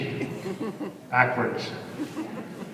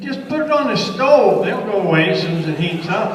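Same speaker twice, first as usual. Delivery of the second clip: voicing Bill; 8 semitones higher than usual. Bill's voice in the story.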